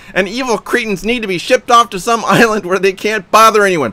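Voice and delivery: high, loud furious rant; raging read of his own comment; frothing at the mouth. Retold or imitated. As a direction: spit the insults out in a rage. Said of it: "I'm frothing at the mouth".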